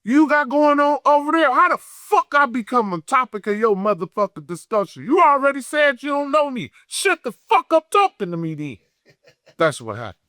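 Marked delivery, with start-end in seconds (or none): 0.0-8.8 high, heated rant replaying the argument; retold angry tirade. Retelling how he cussed out the guest, heated and raised. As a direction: relive telling him to shut up, all worked up.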